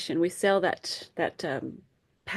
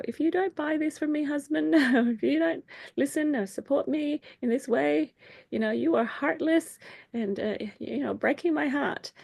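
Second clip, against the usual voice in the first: guilt-tripping wife; 8 semitones higher than usual. Acted. Wife guilt-trips her husband.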